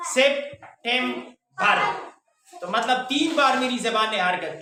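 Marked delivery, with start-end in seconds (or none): 0.1-1.8 September very slowly, in three beats; slow demo of each tongue movement; syllable by syllable. Shown as a demonstration. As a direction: break it as sep, tem, bar with pauses. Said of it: "जबान की हरकत पर गौर करें".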